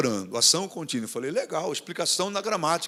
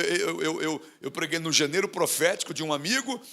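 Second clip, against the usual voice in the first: normal talking voice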